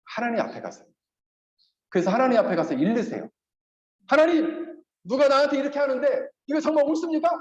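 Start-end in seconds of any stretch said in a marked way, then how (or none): 4.1-7.3 arguing with God. Pretend. Pastor shows how to complain to God, indignant. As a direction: protest to God, demanding an answer. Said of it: "하나님 가서 따지세요".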